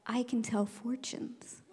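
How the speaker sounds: slow unvoiced whisper; mysterious whisper. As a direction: lure them in with mystery. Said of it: "I said".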